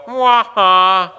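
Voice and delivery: loud teasing mock laugh. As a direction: gloat with a hammed-up laugh. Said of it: "we'd go".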